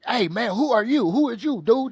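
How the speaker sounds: hammed-up linebacker lifting a kid; teasing big linebacker. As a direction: tease the little guy from high above.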